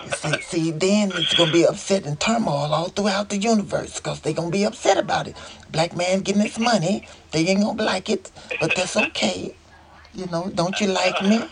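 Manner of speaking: high, playful mock [name]; [name]'s funny voice. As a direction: tease like [name] sharing a prophecy. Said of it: "You know, he would do that".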